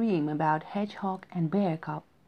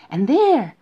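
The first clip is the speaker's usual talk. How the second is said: suspenseful burst; 4 semitones higher, 11 dB louder than usual. A narrator's reading. Hare building suspense.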